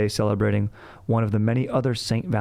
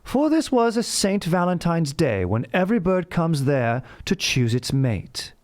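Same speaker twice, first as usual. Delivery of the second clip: put-on slow, higher, grand British reading of old verse; slow theatrical British accent, pitched up; 9 semitones higher, slower than usual. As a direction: recite it like a posh English poet, slow and lofty. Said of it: "I'll do this in a British accent".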